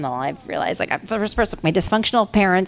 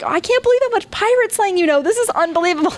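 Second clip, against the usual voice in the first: amazed; 13 semitones higher than usual. Retold or imitated. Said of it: "the guys were like".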